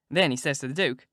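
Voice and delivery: usual speaking voice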